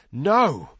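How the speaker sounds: frustrated denial, pushed up and out. A narrator's reading. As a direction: burst out in frustration. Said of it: "his face twisted in frustration".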